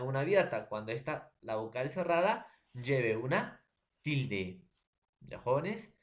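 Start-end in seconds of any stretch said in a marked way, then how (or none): 2.7-4.7 slow emphasis. Shown as a demonstration. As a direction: stretch "lleve una tilde" out, slow and weighted. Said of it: "teacher stresses the condition". (despacio y marcado)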